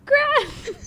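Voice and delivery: very high, drawn-out Gran; whiny plea. Pretend. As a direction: beg like a child. Whining at Gran.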